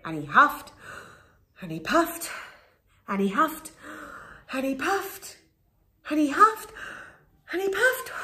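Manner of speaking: climbing pitch with each puff; building effort. A narrator's reading. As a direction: build the puffing up. Wolf puffs harder and harder.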